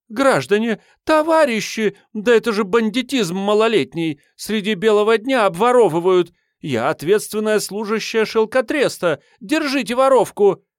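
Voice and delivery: high, loud calling for help; shrieking lady. Acted. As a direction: shriek to the crowd.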